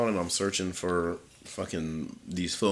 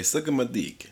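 higher-pitched mock Italian; bad Italian accent; 6 semitones higher than usual. Joking to his friend about dog parks, bad Italian accent. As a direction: put on a terrible Italian accent. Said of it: "worst Italian accent I've ever heard".

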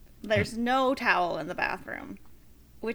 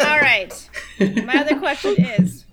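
loud, higher, through laughter; laughing while talking; 3 semitones higher, 7 dB louder than usual. Real, not acted. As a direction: push on through the giggles. Laughing when asked for next question.

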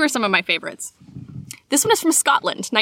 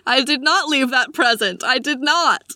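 slow, odd put-on voice; Tommy Wiseau; 2 semitones higher, slower than usual. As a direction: deny it slowly in a comic impersonation. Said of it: "Tommy Wiseau heard that Santa".

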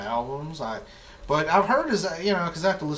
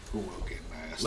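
sultry narrator; 5 semitones lower, 6 dB quieter than usual. Pretend.

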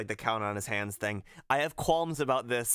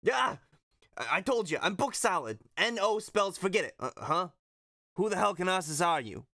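irritable trainer, pitched up; Phil; 6 semitones higher than usual. Acted. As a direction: play a busy, put-upon coach. Acting out Phil's lines.

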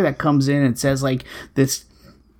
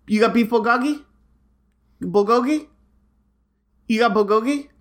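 higher-pitched, repeated questions tossed at nobody; re-enacted pushy customer; 5 semitones higher than usual. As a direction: imitate an oblivious customer, each order rising into a question. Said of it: "recreate this exactly how it happened".

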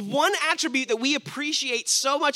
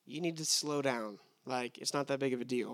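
lower, softer, brushing it off; mock dismissive progressive; 9 semitones lower, 11 dB quieter than usual. Preacher mimicking progressive Christians, mildly dismissive and low. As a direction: play down the worry like it's nothing. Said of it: "progressive Christians would probably say".

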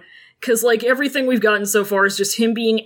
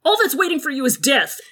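fast, higher-pitched pleading; urgent warning; 6 semitones higher, faster than usual. Acted. Wei Wuxian warning Jiang Cheng off, urgent and fast. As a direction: rush the warning out to stop him. Urgent argument in acted quarrel.

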